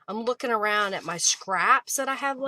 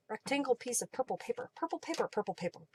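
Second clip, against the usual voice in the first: quick, soft tongue-twister run; said really fast; 10 dB quieter, faster than usual. Shown as a demonstration. Crafter trying purple paper as a tongue twister, fast. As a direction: rattle it off quietly like a tongue twister. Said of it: "Say that really fast".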